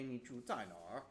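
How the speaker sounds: 哪儿 said with the Beijing R, nar, quick and easy; Beijing accent. Shown as a demonstration. Teacher to learners, showing the Beijing accent R on 哪儿. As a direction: roll the Beijing R onto 哪儿, nar. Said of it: "if you wanted to use a Beijing accent".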